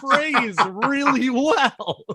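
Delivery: high, laughing mock protest; laughing excuse. Friend voicing him defending his one phrase, high and laughing. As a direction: plead through giggles. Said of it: "And you were like".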